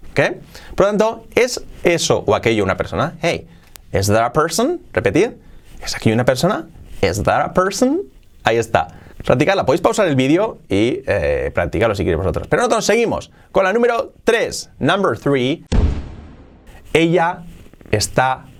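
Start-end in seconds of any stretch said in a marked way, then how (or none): none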